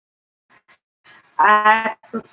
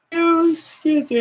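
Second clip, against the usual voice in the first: yawning; 9 semitones higher, slower than usual. Real, not acted. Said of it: "I'm yawning".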